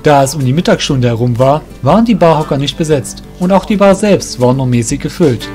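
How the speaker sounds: ordinary delivery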